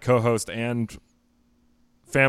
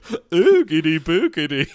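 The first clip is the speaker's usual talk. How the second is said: spooky lisp; 7 semitones higher, 8 dB louder, slower than usual. Shown as a demonstration. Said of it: "How do you ooh with a lisp?".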